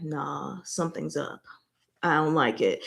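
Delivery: low and wary, under the breath; suspicious mutter. Retold or imitated. Voicing the wary prince in a low wary murmur. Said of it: "Jing Shao was just like".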